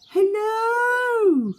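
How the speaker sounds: high, loud long call across the water. Acted. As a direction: call out to the far side. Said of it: "he called".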